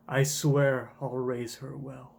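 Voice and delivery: slow solemn promise; understated slow oath; slow vow. Acted. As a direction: vow it slowly. Kuma's vow.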